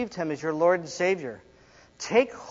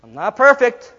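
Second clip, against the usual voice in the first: mock excuse; 7 semitones higher, 10 dB louder than usual.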